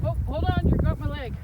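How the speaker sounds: startled call. Real, not acted. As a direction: stop the dog, alarmed. Dog tangles leg.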